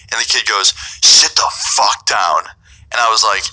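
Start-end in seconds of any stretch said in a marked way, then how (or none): 1.0-2.5 slow, weighted mock command; threatening order. Drunk host ordering guest to stay, slow retold threat. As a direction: imitate a menacing host, each word drawn out.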